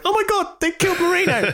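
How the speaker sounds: very high cartoon catchphrase voice; mock horrified outcry. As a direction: parody a cartoon kid's shocked cry. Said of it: "I could not stop going".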